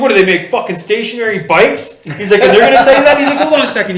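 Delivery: mock-furious Dana; retold outrage. Dana mocking the bike sponsor to Theo, outraged. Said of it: "He was mad".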